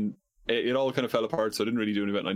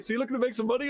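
high mocking saleswoman voice; mock woman caller; 13 semitones higher, much faster than usual. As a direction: imitate the chirpy caller's pitch. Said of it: "And she's like".

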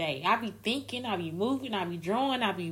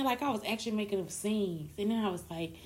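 normal talking voice

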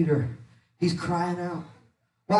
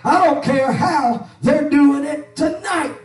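high, loud fiery preacher's cry; preaching at full force; 8 semitones higher, 6 dB louder than usual. Acted. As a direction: preach it out hard over the music. Preaching over music.

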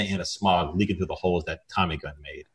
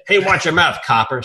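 loud, high indignant yelp; hammed-up secretary screech; mock scream; 8 semitones higher, 5 dB louder than usual. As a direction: yell it from across the room. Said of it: "screamed Winston".

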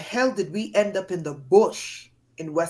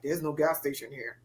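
said to myself; 5 semitones lower, 8 dB quieter than usual. Retold or imitated. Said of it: "said it to myself".

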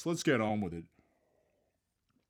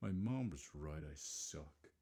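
mock self-pity; 6 semitones lower, 10 dB quieter than usual. Self-pitying aside.

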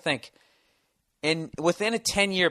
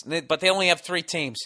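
ordinary talk

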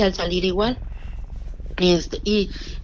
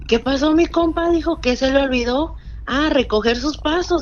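lightly imitated taunt, higher and louder; mock-friendly jeer, pitched up, as a retold quote; 6 semitones higher, 4 dB louder than usual. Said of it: "así se le parecía la voz y burlona". (tono burlón, imitado levemente)

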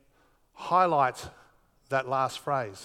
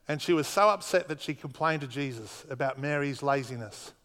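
usual speaking voice

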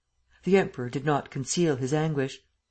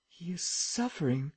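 muttered; 2 semitones higher, 7 dB quieter than usual. A narrator's reading. Waldemar murmuring to the Emperor, muttered. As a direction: mutter it under the breath. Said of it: "muttered Waldemar".

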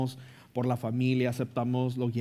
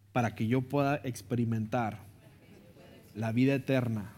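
guided prayer. Acted. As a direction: lead the prayer phrase by phrase. Leads a repeat-after-me prayer.

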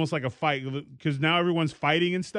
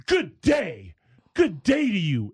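higher, lofty dismissive farewell; haughty good day; 5 semitones higher, slower than usual. Retold or imitated. Reality-TV lady walking out on her rival, lofty snub. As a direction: fire off a prim good day. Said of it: "fired a good day at her".

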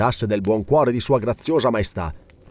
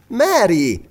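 high, urgent call; exclaiming; 8 semitones higher, 4 dB louder than usual. A narrator's reading. Lady calls to the departing girl, exclaiming. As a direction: call her name out to stop her. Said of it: "esclamò Elena".

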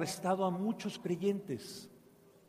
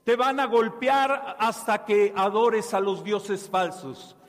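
angry threat; 4 semitones higher, 7 dB louder, slower than usual. A narrator's reading. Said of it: "él le dijo, enojado".

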